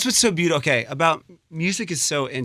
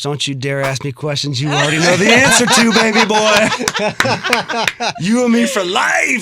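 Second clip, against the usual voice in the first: laughing; 8 semitones higher, 10 dB louder than usual. Real, not acted. Teasing friend, loud and laughing.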